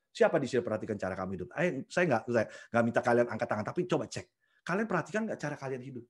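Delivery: ordinary delivery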